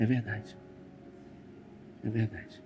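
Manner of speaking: low, very soft murmur of assent; imitated quiet agreement. Mourners nodding at the pastor's words, imitated hushed murmur. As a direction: drop pitch and volume, imitating hushed agreement.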